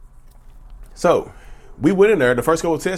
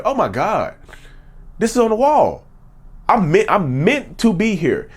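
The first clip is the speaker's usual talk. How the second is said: stunned realization; 7 semitones higher than usual. Retold or imitated. Telling viewers about seeing his screensaver quote: amazed, higher. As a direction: pitch jumps higher in amazed disbelief.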